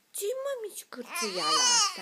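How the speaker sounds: playful high coo. Real, not acted. Mother imitates baby's squeak.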